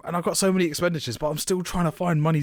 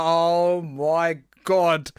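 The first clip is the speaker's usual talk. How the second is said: stunned; 3 semitones higher, much slower than usual. Retold or imitated. Hearing the price confirmed, stunned. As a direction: let it sink in slowly.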